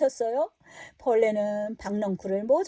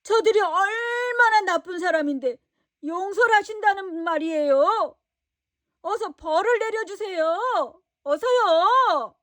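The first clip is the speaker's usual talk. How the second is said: higher, louder whiny pleading; whining demand; 6 semitones higher, 4 dB louder than usual. Acted. Jonah demands punishment, whining. (벌을 내려달라고 높은 소리로 조르는 요나)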